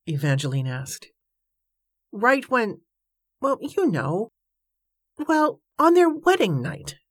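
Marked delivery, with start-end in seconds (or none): 2.1-7.0 higher-pitched, halting embarrassment; stammering. A narrator's reading. Embarrassed riverman hints at the wedding night, stammering. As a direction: hesitate and break off, pitch climbing. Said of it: "Joshua stammered".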